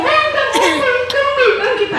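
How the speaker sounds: very high sobbing denial; crying child. Acted. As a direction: wail it like a frightened kid. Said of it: "Andi so menangis".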